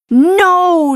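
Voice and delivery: high, drawn-out cry of no; dismayed storybook gasp, pitched high. A narrator's reading. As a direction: gasp it out in alarm. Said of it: "she gasped".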